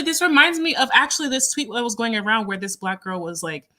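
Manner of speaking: ordinary delivery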